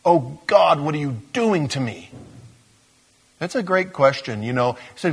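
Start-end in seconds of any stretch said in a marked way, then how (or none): 0.0-2.1 anguished plea. Acted. Preacher voicing David's plea to God, acted anguish. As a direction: plead it as a hunted man's prayer.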